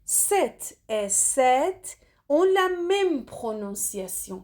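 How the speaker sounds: each word drawn out for the class; slow emphatic teaching. Shown as a demonstration. Teacher to students, stressing the rule, slowly. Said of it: "Faites attention".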